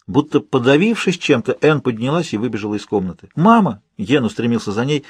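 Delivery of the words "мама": higher, urgent cry; calling out. A narrator's reading. Son calling to mother as she runs out, urgent cry. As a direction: call after her in alarm.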